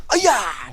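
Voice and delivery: sharp loud martial arts kiai, high; kiai shout. Shown as a demonstration. Instructor leading the class shouts a loud kiai. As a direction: snap out a fighting yell. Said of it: "Do it with me".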